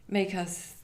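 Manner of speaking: ordinary delivery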